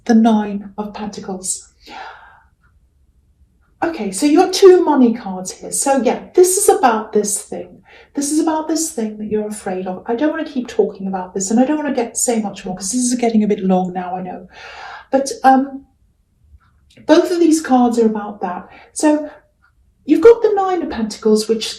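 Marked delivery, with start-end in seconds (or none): none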